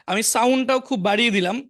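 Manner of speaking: loud announcement. Real, not acted. Teacher loudly announcing that studying has started. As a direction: boom it out so everyone hears. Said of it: "আমি sound প্রচন্ড বাড়িয়ে দিয়েছি".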